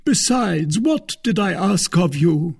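a touch of theatrical grievance; aggrieved suitor. Acted. Porthos presses his grievance.

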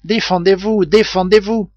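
frightened warning to his rescuers; urgent alarm. A narrator's reading. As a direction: urge them to fight, in panic. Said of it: "s'écria Ayrton".

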